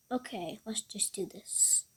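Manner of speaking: unvoiced whisper to himself. Real, not acted. Player psyching himself up, whispered. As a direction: breathe it out as a quiet resolve.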